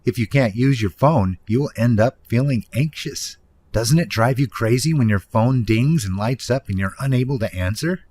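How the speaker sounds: normal talking voice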